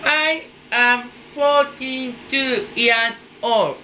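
normal talking voice

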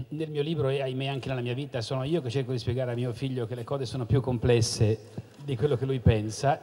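ordinary talk

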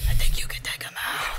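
unvoiced whisper to a teammate. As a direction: whisper it so the goblins can't hear. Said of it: "We gotta be quieter".

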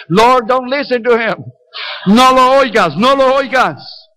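high, drawn-out call to heaven; mock pleading prayer. Preacher praying against the passenger's plea, comic drawn-out call. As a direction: call out over him in comic supplication, stretching the vowels.

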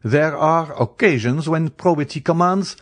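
lofty moral objection; haughty. A narrator's reading. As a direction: stand on principle, haughtily. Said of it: "replied Beauchamp haughtily".

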